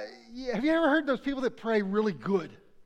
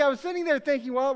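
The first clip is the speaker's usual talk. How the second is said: ordinary talk, nothing marked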